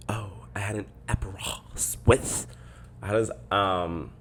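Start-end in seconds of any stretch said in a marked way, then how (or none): 0.1-2.5 whisper. Real, not acted. Remembering her drink aside.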